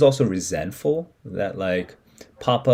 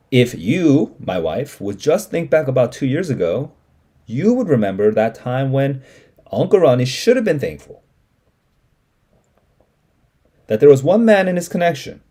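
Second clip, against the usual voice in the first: Papa arguing; 5 semitones higher, 5 dB louder than usual. Acted. Papa arguing with his wife.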